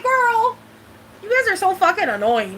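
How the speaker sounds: high, exasperated eye-roll retort; exasperated quote. Retold or imitated. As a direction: squeal it at them, fed up. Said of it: "I was like".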